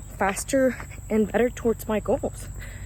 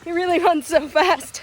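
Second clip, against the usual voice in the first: playful praise; 7 semitones higher, 5 dB louder than usual. Real, not acted. Praising child pacer on camera.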